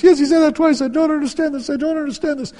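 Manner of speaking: very high, loud, mock-crying wife voice; tearful wife. Wife confirms repeated words to husband, high mock-tearful insistence. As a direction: insist in a squealing imitation of her. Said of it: "and she starts crying".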